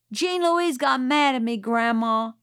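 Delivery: put-on high, loud child's call to Grandma; boy calling to Grandma to tell on Scout. A narrator's reading. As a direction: whine it out to the grown-ups. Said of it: "called Francis".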